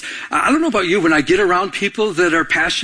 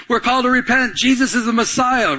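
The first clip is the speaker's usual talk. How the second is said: eager preaching; 8 semitones higher than usual. Pretend. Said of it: "So he's going around talking".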